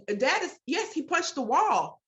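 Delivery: ordinary talk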